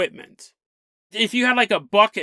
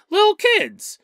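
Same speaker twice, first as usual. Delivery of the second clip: indignant emphasis; 11 semitones higher, 4 dB louder, slower than usual. Real, not acted. Outrage at rocks thrown at children.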